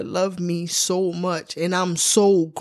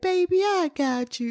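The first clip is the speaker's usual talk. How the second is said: tender mom; 11 semitones higher, 4 dB quieter than usual. Retold or imitated. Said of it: "I want her to be like".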